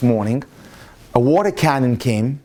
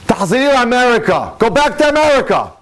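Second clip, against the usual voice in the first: higher and louder, a policeman barking her away; mock angry shout; 8 semitones higher, 3 dB louder than usual. Policeman shouting at the American protester, hammed-up anger. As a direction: imitate a gruff officer yelling back, rising. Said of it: "Like that".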